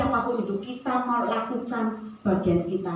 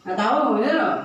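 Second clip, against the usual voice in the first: put-on sulky teen snap; 5 dB louder than usual. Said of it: "Tasya lagi jutek".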